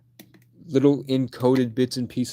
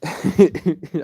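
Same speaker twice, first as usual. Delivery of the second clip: real laugh; 6 semitones higher, 6 dB louder than usual. Real, not acted. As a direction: burst of loud laughter. Laughs at own rant.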